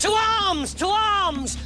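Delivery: high, urgent shouted alarm; officer shouting the troops to arms; alarm call. Acted. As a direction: cry the alarm down the line.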